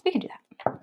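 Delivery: soft unvoiced whisper. Real, not acted. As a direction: whisper it back to yourself. Whispered repeat of same line.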